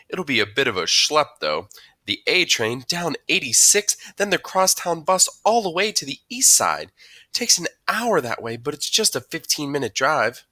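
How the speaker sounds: high, loud voice of a mom listing a long trip; chatty mom. A narrator's reading. Mom tells teacher about the schlep, chatty. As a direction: play a mom going on about the commute.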